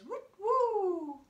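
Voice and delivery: loud high owl call; owl hoot. Shown as a demonstration. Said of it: "He goes".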